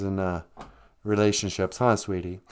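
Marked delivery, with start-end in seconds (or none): none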